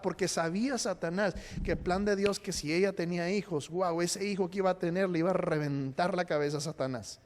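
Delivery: ordinary talk, nothing marked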